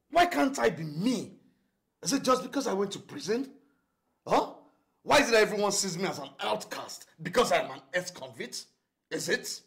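high-pitched, loud, indignant questioning; agitated outburst. Acted. Ex-convict protesting to Laura, high agitated outburst.

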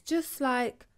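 ordinary delivery

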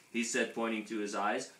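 ordinary delivery, nothing marked

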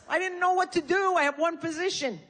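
ordinary delivery

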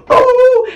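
very high, loud ooh; shrill ooh. Retold or imitated. Reacting to the concussion, shrill ooh.

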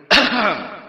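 loud, pointed throat clear; demonstrative throat clearing. Shown as a demonstration. Painter at the front door announces himself, loud throat clearing. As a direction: clear the throat to get attention. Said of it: "he would clear his throat".

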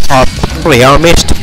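ordinary delivery

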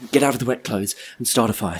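usual speaking voice

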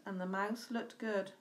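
usual speaking voice

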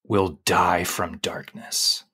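slow, hushed spooky prophecy fading to a whisper; ominous child quote. Retold or imitated. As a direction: intone it like a dark omen, slowly. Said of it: "And he goes".

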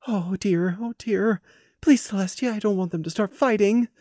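soft, high, worried murmur; a hint of a frightened mare; anxious fretting. A narrator's reading. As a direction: fret under your breath. Frightened pony fears a fight.

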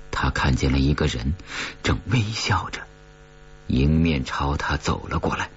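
ordinary delivery, nothing marked

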